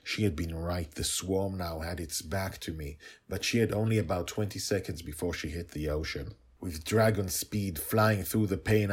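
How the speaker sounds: ordinary delivery, nothing marked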